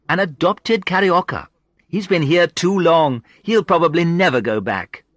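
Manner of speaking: high, loud, playful woman's voice; teasing Sandra. A narrator's reading. Sandra teases Paul about staying in Rio, playfully. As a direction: rib your friend in a high, bright lilt.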